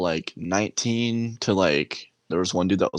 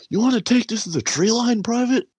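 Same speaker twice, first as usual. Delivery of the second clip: much higher, louder mock bark; drill sergeant; 4 dB louder than usual. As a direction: threaten him like a drill instructor. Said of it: "the drill sergeant's like".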